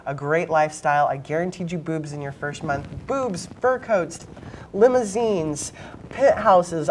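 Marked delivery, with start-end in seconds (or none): 3.1-6.9 tempting, drawn-out list of luxuries; enticing sales pitch. Retold or imitated. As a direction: dangle each luxury like bait. Pimp luring girls with lifestyle.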